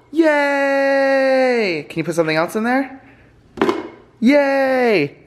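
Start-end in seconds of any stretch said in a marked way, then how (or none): 0.1-1.8 high, drawn-out yay; playful high-pitched celebration; cheering. Real, not acted. Cheering on a small child. 4.2-5.2 high, drawn-out yay; playful high-pitched celebration; cheering. Real, not acted. Cheering on a small child.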